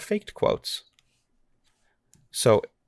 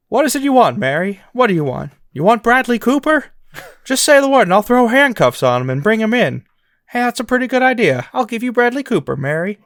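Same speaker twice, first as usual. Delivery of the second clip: higher and louder, a hammed-up old-movie hero; George Bailey impression; 8 semitones higher, 5 dB louder, faster than usual. Host doing George Bailey in a joke fake quote, loud and eager. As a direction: oversell the promise, grinning. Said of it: "I'll do the impression".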